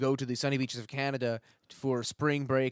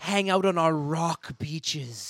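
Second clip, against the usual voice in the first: tourism ad voice; 4 semitones higher, 3 dB louder, slower than usual. Pretend. Mock tourism ad.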